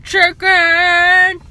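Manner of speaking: high, loud cry of chicken; loud craving call. Real, not acted.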